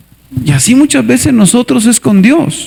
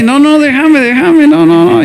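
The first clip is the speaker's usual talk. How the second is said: mock drunk protest; 5 semitones higher than usual. Pastor playing a drunk refusing his friend, high mock protest. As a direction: push him off like a stubborn drunk. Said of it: "Entonces yo así".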